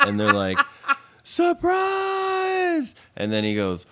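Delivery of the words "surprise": high-pitched crowd yell of surprise; surprise shout. Retold or imitated. Guests greet the birthday man as he walks in, surprise shout. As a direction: shout it high like a whole party.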